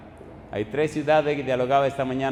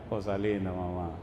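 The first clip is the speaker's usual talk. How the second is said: much softer and lower, tender and soothing; tender endearment; 8 semitones lower, 9 dB quieter than usual. Retold or imitated. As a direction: coo it gently, like calming a child. Pastor comforts woman before praying. (dulce y tierno, mucho más suave y grave)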